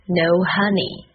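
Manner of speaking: normal talking voice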